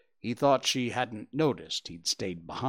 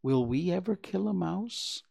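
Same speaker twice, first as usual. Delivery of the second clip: anxious; 8 semitones higher than usual. A narrator's reading. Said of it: "feeling rather anxious".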